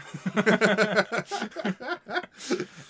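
loud high burst of laughter; big laugh. Real, not acted. Cohost laughing at her own joke.

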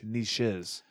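"niches" said nee-shiz, with a soft sh; long ee and sh in "niches", said low and soft; French-style pronunciation. Shown as a demonstration. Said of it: "I don't know which one's actually correct".

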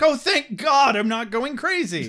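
high, loud gush of relief; hammed-up grateful outburst; mock relief. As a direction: blurt it like a desperately relieved dad. Dad relieved he isn't crazy.

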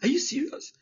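sudden high disbelieving question; incredulous. Retold or imitated. Son reacting to father's order to start a school, incredulous high squeak. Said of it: "I said".

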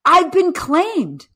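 high, slow, happy son's voice. Retold or imitated. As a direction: say it slowly like a beaming son. Son's voice in dream story.

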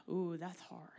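whispered aside; hushed ooh at a hard verse. Real, not acted. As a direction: breathe it out as a private wince. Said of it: "reacting to scripture".

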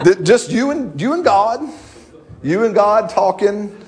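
ordinary talk

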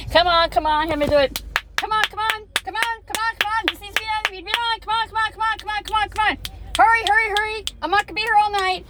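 high, rushed hurry-up prodding; drill instructor. Retold or imitated. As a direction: hustle him like a drill instructor. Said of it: "drill instructed and constantly".

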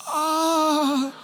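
high, drawn-out cringing moan; mortified "oh". Retold or imitated. Overhearing the waitress mock them, a high mortified moan. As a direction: hold a long, high embarrassed "oh".

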